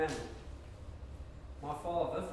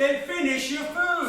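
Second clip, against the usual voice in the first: angry command; 7 semitones higher, 10 dB louder than usual. Acted. Frank yells order back.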